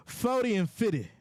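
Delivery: Forty and fifty pitched high with a falling finish; emphatic guess. Shown as a demonstration. Host showing how to say his age guess to Becky, high and emphatic. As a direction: punch Forty high, then let fifty fall. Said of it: "You gotta say it like that".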